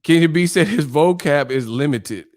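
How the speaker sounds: ordinary talk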